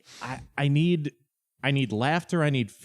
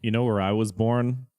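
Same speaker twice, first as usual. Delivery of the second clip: ordinary delivery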